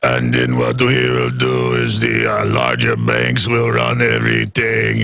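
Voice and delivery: low, slow mock German-accented drawl; Henry Kissinger impression. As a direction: ham up a ponderous Kissinger, deep and deliberate. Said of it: "I'll be Henry Kissinger".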